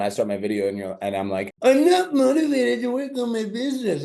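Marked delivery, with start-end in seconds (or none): 1.6-4.0 much higher, laughing mock whine; a hammed-up whine with a laugh in it; whiny unmotivated voice. Shown as a demonstration. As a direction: play the sad unmotivated client, squeaky and silly. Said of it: "an example is".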